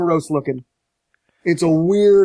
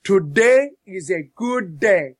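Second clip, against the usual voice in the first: slow solemn quote; slower than usual. Retold or imitated. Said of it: "I quote".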